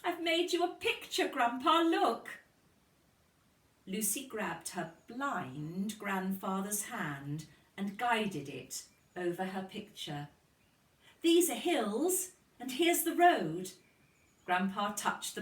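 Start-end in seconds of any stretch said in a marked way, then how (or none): 0.0-2.4 high and louder, eager to show off; a little girl who can't wait to show him; excited child. A narrator's reading. Lucy showing her blind grandfather her picture, high and excited. 11.2-13.7 put-on excited child. A narrator's reading. Lucy guides grandpa's hand.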